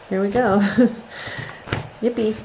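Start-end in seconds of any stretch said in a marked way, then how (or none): none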